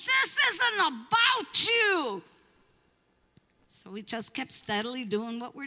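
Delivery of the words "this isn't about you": high, loud, slow furious yell; angry screaming. Retold or imitated. Angry locals screaming at the pastor to leave. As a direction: scream it at the intruders. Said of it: "they started screaming and cussing".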